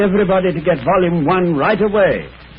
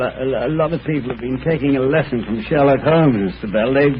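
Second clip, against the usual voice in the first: ordinary delivery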